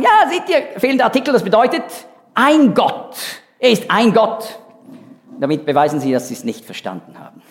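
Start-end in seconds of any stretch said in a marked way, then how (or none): 0.0-4.6 high, eager mock know-it-all voice; mocking Jehovah's Witnesses. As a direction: mimic smug critics with a raised pitch. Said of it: "kommen die Zeugen Jehovas und sagen".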